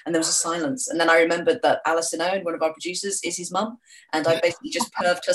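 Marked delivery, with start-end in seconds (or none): none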